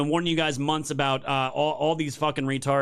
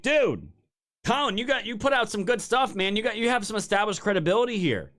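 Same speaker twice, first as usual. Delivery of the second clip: pleading appeal; 6 semitones higher than usual. Retold or imitated. Pleading retold appeal to Colin.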